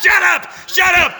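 very high, loud mock-hysterical shout; hurt outburst. Laughed-at member yells at the room before fleeing, hurt outburst. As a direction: yell it like a humiliated runaway.

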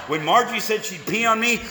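usual speaking voice

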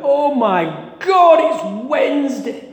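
high, drawn-out horrified wail; acted dawning horror, stretching the words out; panicked realisation. Hungover bartender realising it is his workday, acted panic.

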